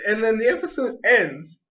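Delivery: normal talking voice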